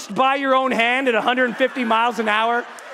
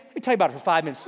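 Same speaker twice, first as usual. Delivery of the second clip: very fast, low rattle; mock breezy brush-off; offhand advice; 9 semitones lower, much faster than usual. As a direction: toss it off at top speed. Mimicking breezy advice givers.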